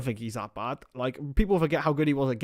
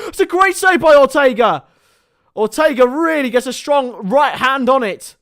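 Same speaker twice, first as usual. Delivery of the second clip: loud, higher, building football commentary that peaks on the save; excited play-by-play; 7 semitones higher, 10 dB louder than usual. Acted. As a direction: call the attack like a TV commentator on a big chance. Live play-by-play of attack.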